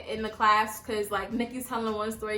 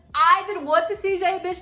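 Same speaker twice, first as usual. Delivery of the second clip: loud, higher outraged comeback; angry yell; 7 semitones higher, 6 dB louder than usual. Retold or imitated. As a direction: fire it back, furious. Retold yelling match reply.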